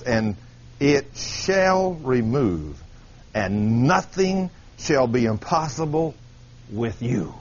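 slow, low, weighty Bible reading; solemn scripture reading. Acted. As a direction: stretch each phrase with deliberate weight. Reads scripture aloud.

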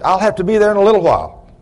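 high, quick mock holler; imitating a man hollering down; retold holler. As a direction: call across a distance, lightly acted. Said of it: "I hollered at him".

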